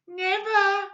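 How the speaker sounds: put-on high, squeaky worried old woman; crying Missus Twit. A narrator's reading. Frightened wife crying out, high and squeaky. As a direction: cry out in alarm like an old hag. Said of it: "she cried".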